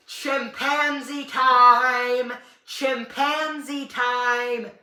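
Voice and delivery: high, loud, drawn-out war cry; imitated rallying yell. Friend jumps up on the couch yelling, imitated rallying yell. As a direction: stretch each word out in a high-pitched holler.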